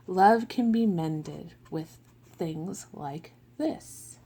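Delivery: slow, gentle storybook read; picture-book reading with voiced characters; reading to children. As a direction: read it like a bedtime book for little kids.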